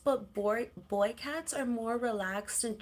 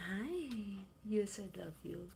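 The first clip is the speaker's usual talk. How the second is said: whispered love; 10 dB quieter than usual. Real, not acted. Whispering to the cat.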